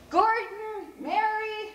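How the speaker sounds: high, loud, drawn-out call; sing-out parent's holler, names held long; calling children. Acted. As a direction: call the kids home across the yards. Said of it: "we heard Mister Dayton call".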